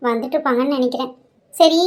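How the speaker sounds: ordinary delivery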